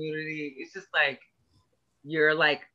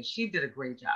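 talking while eating; words round a bite of cookie; mouth full; 4 semitones lower, 6 dB quieter than usual. Real, not acted. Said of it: "I'm sorry, I'm eating".

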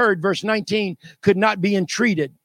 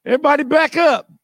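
louder, commanding shout to back up; Moses ordering crowd; 4 semitones higher, 3 dB louder than usual. Retold or imitated. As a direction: push the crowd back with your voice. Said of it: "He said".

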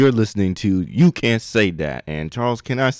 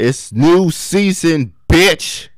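hyped announcement; 7 semitones higher, 5 dB louder, much slower than usual. Acted.

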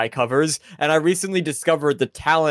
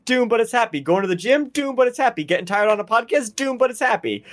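hammed-up Italian-flavoured ad pitch, higher; Italian accent pitch; 6 semitones higher than usual. Pitching a silly song to podcast friends, mock Italian ad voice. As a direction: sell it like a cheesy Italian TV ad. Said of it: "Your Italian really came out".

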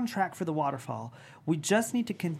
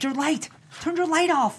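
loud urgent warning, rising; urgent shout; 8 semitones higher, 5 dB louder than usual. Acted. Friend on the tower warned by urgent shout. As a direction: yell at him to kill the light.

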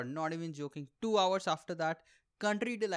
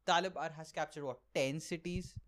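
usual speaking voice

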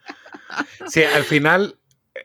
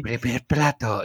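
slow, softer ghostly voice; voice from beyond the grave; 6 dB quieter, slower than usual. Shown as a demonstration. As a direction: intone it like a voice from the grave. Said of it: "me sale como una voz de ultratumba".

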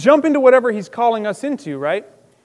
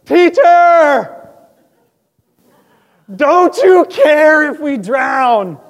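high, loud, slow cry of panic; terrified disciples shout over the storm; desperate shout; 7 semitones higher, 9 dB louder, much slower than usual. Retold or imitated. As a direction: yell it at the teacher as the boat sinks. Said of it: "they're like".

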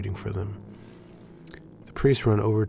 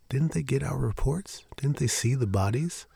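whispering; 5 semitones higher than usual. Acted. Anxious hushed questions.

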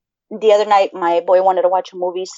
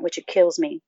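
ordinary talk, nothing marked

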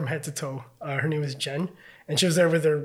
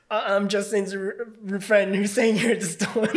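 hesitant, slow and higher; nervous and awkward; 4 semitones higher than usual. Retold or imitated. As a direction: stammer it out like an awkward guest. Awkwardly introducing himself to stranger.

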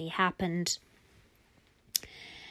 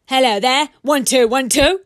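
loud, higher barked voice; grumpy, yelly toy; 6 semitones higher, 13 dB louder than usual. Acted. The toy speaks back to Papa, yelling. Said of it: "and yelly".

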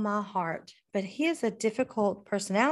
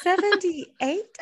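much higher, slow, incredulous question; disbelieving echo; 10 semitones higher, 4 dB louder, much slower than usual. Retold or imitated. Daughter echoing dad's thermostat number, aghast. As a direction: drag out the number in shock.

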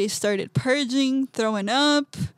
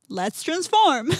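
higher, louder and slow, with resolve; determined declaration; 5 semitones higher, 6 dB louder, slower than usual. Retold or imitated. Telling the shaman she's ready, slow and resolved. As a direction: announce it like a vow, drawn out. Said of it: "I said".

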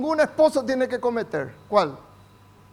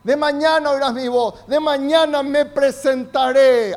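louder, driving sermon voice; fervent preaching; 5 semitones higher, 6 dB louder than usual. Acted. Pastor urges the church to pray aloud, fervent and louder. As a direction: preach it out with conviction.

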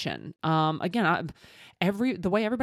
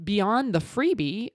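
ordinary talk

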